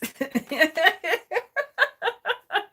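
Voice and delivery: real laugh tumbling out in pulses. Real, not acted. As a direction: burst into a long run of laughter. Laughs after misread word.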